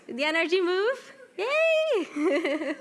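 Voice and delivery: high, loud, laughing cheer; loud happy yay with laughter; excited cheer. Real, not acted. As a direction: celebrate with the class, laughing. Cheering class after exercise.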